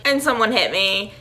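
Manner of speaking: ordinary delivery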